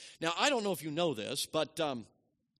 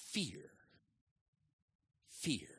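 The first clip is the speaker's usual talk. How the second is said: hushed; 5 dB quieter than usual. Acted. One-word answer, dropped to hush.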